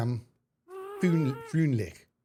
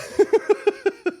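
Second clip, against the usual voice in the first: real laugh at herself; loud high laugh; 7 dB louder than usual. Real, not acted. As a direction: burst of high-pitched laughter. Laughing at own German attempt.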